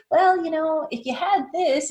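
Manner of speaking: high, teasing conditional reply; a touch of a coy hedge; coy bargaining. Retold or imitated. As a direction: dangle a condition playfully.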